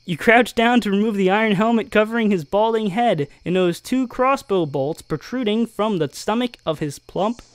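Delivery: ordinary talk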